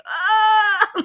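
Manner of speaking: high, loud mock scream with a laugh; startled shriek. Vlogger reacting to Grandma blowing ice, mock scream. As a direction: shriek in playful shock. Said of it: "and I'm like".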